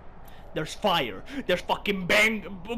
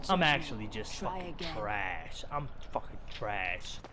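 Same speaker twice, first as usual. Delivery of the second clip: low, very soft self-reproach; deflated, sunken voice; dejected; 10 semitones lower, 9 dB quieter than usual. Real, not acted. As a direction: give up on yourself quietly. Gamer dejected at own play.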